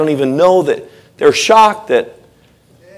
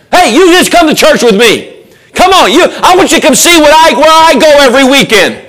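high, pleading call to a friend; pushy friendly invite; eager invitation; 9 semitones higher than usual. Acted. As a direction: coax them to come along.

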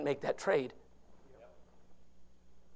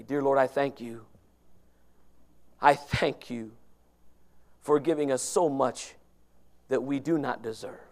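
closing prayer; 6 semitones lower than usual. Acted. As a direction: pray humbly and thankfully.

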